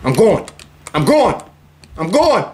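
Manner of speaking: building hurried assurance; rising urgency. Real, not acted. Gamer fleeing upstairs, increasingly urgent.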